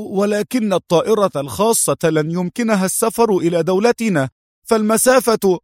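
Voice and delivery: put-on high-pitched, puzzled young subordinate; surprised objection. A narrator's reading. Young man doubting the boss's plane plan, surprised. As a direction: object in a high voice until cut off. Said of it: "رفع الشاب حاجبيه دهشة".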